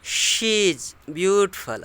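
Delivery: "beautiful" said carefully, each syllable slow and clear; slow model reading of "She is beautiful"; correct pronunciation. Shown as a demonstration. Teacher modelling correct "beautiful" for students, slow and careful. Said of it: "उसको बोलते हैं ब्यूटीफुल".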